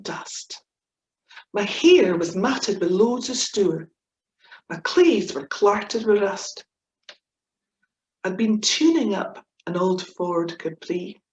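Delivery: lively comic verse reading; poem recitation. Acted. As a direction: perform the poem with gusto. Reciting her comic Scots poem.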